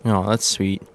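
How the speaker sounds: normal talking voice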